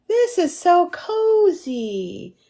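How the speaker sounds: high, slow, dreamy and cozy; cozy drawl. Retold or imitated. Remembering sinking into the beanbag chair, high and slow. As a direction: stretch it out, sleepy and pleased. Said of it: "I was like".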